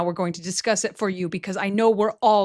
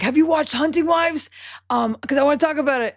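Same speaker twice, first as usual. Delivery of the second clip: excited; 7 semitones higher, 4 dB louder than usual. Retold or imitated. Excited recalled text to friend.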